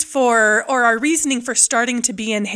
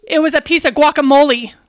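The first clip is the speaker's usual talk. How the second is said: louder, higher punchline; loud reveal; 4 semitones higher, 6 dB louder than usual. Real, not acted. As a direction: blurt out the twist.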